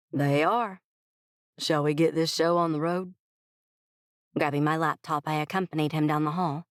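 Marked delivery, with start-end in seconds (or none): 0.1-3.1 easygoing Texan boss ready to start; Texas twang. A narrator's reading. CEO leading the consultant to the meeting, Texas twang. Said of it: "his gentlemanly Texas twang".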